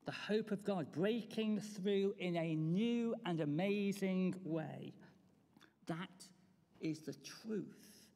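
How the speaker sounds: ordinary talk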